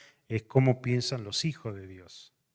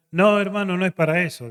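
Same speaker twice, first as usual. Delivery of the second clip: higher, louder brushing-off reply; a hint of the pastor waving it away; lightly voiced pastor; 8 semitones higher, 9 dB louder than usual. Pastor objects in dialogue.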